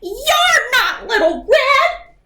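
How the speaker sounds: yelled. Acted. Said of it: "yelled Granny".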